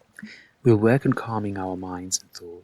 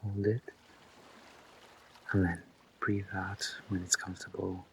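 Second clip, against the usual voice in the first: much softer, near-whispered instructions; hushed breathing guidance; 11 dB quieter than usual. Real, not acted. Guide counting listeners through held breaths, hushed and unhurried. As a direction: talk them softly through each breath, leaving long pauses.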